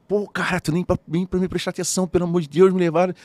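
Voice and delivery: high, rising to loud indignant rant; exasperated complaint. Retold or imitated. Scolding his friend for not noticing he was taken, high and loud.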